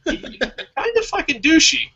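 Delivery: high laughing mock insult; mock jab, laughing. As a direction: tease them through a laugh, high and slow. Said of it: "I'm like".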